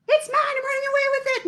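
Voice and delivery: put-on high, fast squeaky kid voice; little boy voice. Teacher voicing little boy grabbing wool, high squeaky. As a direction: play the greedy little boy. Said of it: "the little boy went".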